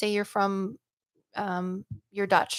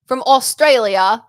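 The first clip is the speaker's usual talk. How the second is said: loud, drawn-out mock Australian accent; cheerfully bad attempt at Australian; 3 semitones higher, 10 dB louder, slower than usual. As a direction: put on a hammy Australian accent, stretching it out slowly. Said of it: "make a bad Australian accent".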